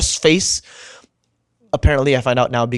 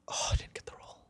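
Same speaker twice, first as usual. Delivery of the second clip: whisper; 15 dB quieter, faster than usual. Retold or imitated. Friend quietly hears bad news.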